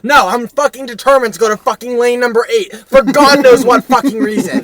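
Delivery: furious shouting rant; angry rant. Retold or imitated. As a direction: yell at the idiot in line. Ranting about slow line.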